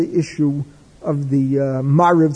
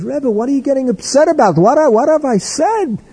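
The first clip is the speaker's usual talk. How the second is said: baffled protest; 8 semitones higher than usual. Retold or imitated.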